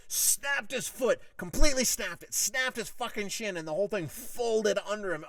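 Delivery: horror rising as the leg breaks; aghast excitement. Real, not acted. Podcaster telling listeners how the shin snapped, appalled and excited.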